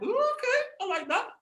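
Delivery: very high, impressed coo; intrigued approval. Retold or imitated. Son warming to dad's idea, high intrigued voice. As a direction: squeak it with playful interest.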